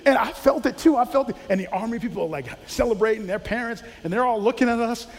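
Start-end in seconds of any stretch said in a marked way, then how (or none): none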